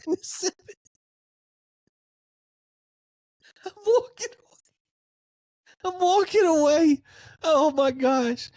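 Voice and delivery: very high, wheezy squeal; breaking up laughing. Real, not acted. Host giving up over the lopsided loss, helpless squealing laughter.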